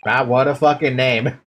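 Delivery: ordinary talk, nothing marked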